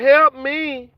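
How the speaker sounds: mock plea. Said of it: "They hollering".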